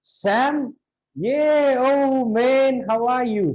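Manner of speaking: high, delighted burst of recognition; amazed greeting. Acted. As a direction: greet a long-lost friend in amazement. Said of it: "he'll be also amazed".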